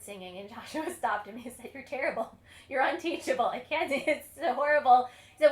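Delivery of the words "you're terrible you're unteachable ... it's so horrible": louder, higher, growing exasperated verdict; blunt rejection. Retold or imitated. Joshua loudly telling a would-be pupil he is unteachable. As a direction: tell him flatly he's hopeless, rising to a cry. Teacher rejecting a bad singer.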